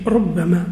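ربما with the doubled b, rubbamā; ربما said with a stressed, doubled b; with the shadda. Shown as a demonstration. Teacher showing how Bani Tamim pronounce ربما. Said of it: "يتلفظونها بالتشديد".

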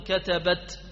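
"كتبت" said katabat, ending on an unvowelled t; silent feminine ta. Shown as a demonstration. Teacher shows class the feminine ta, "كتبت" as katabat. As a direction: end "كتبت" on a crisp -at. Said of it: "ساكنة، يعني ممكن تقول".

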